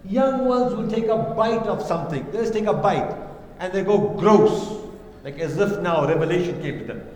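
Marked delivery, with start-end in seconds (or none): 4.0-4.8 mock disgust. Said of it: "with such conviction and yaqeen".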